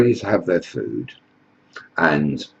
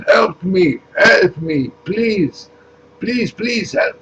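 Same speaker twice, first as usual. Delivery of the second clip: much higher, pleading voice from far below; desperate cry for help; 11 semitones higher, slower than usual. Acted. Drowning migrant begs Jesus Farage from the crevice, hammed-up pleading. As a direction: beg to be pulled up out of the trench. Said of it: "this voice comes up and says".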